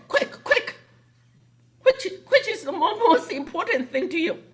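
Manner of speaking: high, rushed, laughing through the question; hurried mock quiz. [name] rushing Tina for an answer, high and laughing. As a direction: squeal the question out between giggles. Boss quizzing Tina in a hurry.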